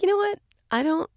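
high mock voice. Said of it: "I was kinda like".

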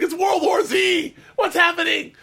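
high, loud, slow mock panic shout; mock panicked traveler. Imitating frantic travelers fleeing the storm, mock panic shout. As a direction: yell it like the world is ending.

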